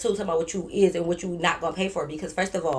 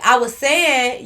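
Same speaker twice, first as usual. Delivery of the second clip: slow, louder opening words; slow, emphatic raised voice; loud start; 4 semitones higher, 6 dB louder, slower than usual. Retold or imitated. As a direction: start loud to grab attention. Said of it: "the first word you get loud".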